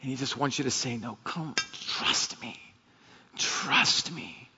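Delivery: hushed, tearful pleading; choked near-whisper, pleading as a loving father. Real, not acted. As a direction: barely get the words out through emotion. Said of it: "I'm getting choked up right now".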